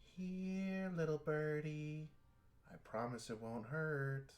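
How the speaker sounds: high, sing-song menacing coax; creepy taunting villain. Acted. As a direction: lure the prey like a sweet-voiced killer. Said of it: "all you hear is".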